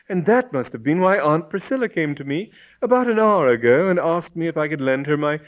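put-on high-pitched, animated girl's voice; lively female character, high; realising young woman. A narrator's reading. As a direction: blurt it out as a guileless girl.

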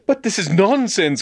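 high-pitched indignant outburst; agitated young man's cry. A narrator's reading. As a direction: protest in sheer disbelief. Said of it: "cried James".

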